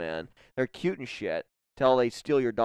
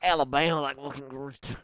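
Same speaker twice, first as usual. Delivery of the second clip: mock Alabama accent; 4 semitones higher, 3 dB louder than usual. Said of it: "That's like Texas. Alabama".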